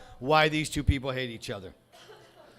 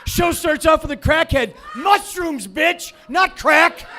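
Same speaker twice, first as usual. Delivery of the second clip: mocking the commenter; 9 semitones higher, 4 dB louder than usual. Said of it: "a few comments from Dixie Boy".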